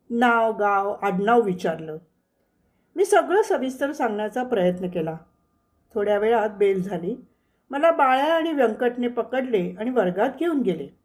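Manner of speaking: plain reading voice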